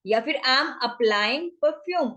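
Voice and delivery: drawn-out teaching delivery; slow demo phrase; slow model phrase. Shown as a demonstration. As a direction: stretch it so learners can follow. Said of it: "तो क्या कहेंगे".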